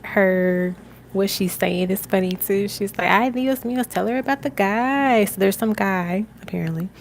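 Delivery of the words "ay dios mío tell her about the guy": higher, lively Spanish-accented imitation; ancestor's heavy accent. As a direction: urge it in a playful Spanish accent. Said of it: "like, a heavy accent".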